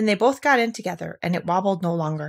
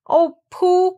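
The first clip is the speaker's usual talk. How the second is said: high, louder anguished cry; 12 semitones higher, 7 dB louder than usual. A narrator's reading. Christopher Robin crying out for Pooh, high and loud. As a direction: call out for a lost friend. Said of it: "cried Christopher Robin".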